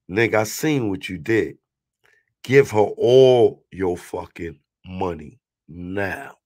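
slow, low, hard warning in his ear; low menacing threat. Retold or imitated. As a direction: lean in and threaten him slowly.

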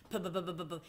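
lip-buzzed motorcycle engine sputter; motor noise. A narrator's reading. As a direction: rev the little engine with your lips. Mouse imitates motorcycle engine.

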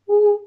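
loud high owl hoot. Pretend. Teacher hoots like the owl for the kids.

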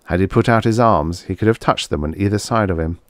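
usual speaking voice

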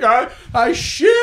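high, drawn-out wail of admission; mock distressed confession. Reenacts confessing to his wife.

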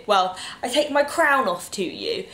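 ordinary talk, nothing marked

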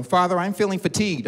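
mock-formal child announcing tiredness; toddler talking like an adult. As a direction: imitate a little girl talking thirty-five. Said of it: "Don't talk thirty-five".

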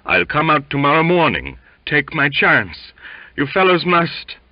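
high, loud, laboured insistence; mumbling sick sailor. A narrator's reading. Sick sailor appealing to his mates, mumbled insistence. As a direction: insist from the sickbed, slowing midway. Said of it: "mumbled Waite".

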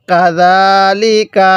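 slow, measured tajweed demonstration; elongated Quranic recitation. Shown as a demonstration. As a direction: draw out the long vowel in কাযালিকা. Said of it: "এক আলিফ টানতে হবে".

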